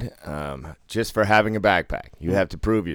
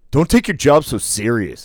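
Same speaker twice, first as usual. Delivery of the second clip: higher, louder sneer; mocking player; 7 semitones higher, 7 dB louder than usual. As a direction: sneer at the dealer. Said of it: "he was like".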